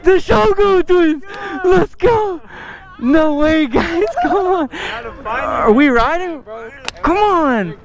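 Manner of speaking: excited high hype; frat bro. Real, not acted. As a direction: hype up the crew. Greeting his friends, hyped.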